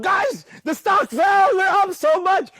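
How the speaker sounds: high, drawn-out mock yell of good news; excited shout. Retells bursting in with news.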